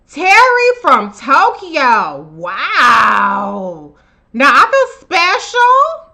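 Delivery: thrilled that a viewer tunes in from Tokyo. Real, not acted. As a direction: gush with surprised joy.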